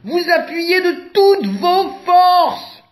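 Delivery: high, loud, slow plea; slow pleading call. A narrator's reading. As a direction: drag out the plea, almost begging. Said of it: "supplie Éléphant".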